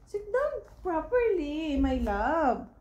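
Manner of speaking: slow sing-song order, starting high. Real, not acted. Mother telling baby to sit. (malambing na utos sa sanggol)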